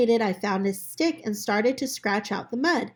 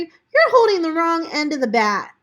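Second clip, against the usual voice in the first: put-on higher, louder know-it-all girl; bossy girl; 7 semitones higher, 7 dB louder than usual. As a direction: correct him like a bossy classmate. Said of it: "said Rosemary".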